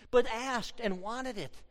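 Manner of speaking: usual speaking voice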